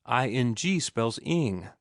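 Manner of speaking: "I N G" spelled letter by letter, slow; spelling out letters. Shown as a demonstration. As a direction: spell it out slowly for a pupil. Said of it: "Sometimes you pronounce it ing".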